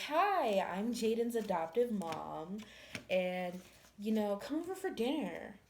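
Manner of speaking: high, bright neighbourly greeting; mock sweet mom. Jaden's mom greeting the neighbour, mock sugary voice. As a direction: put on a sugary welcome. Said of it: "She's like".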